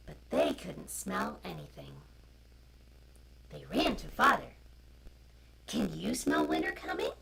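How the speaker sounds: usual speaking voice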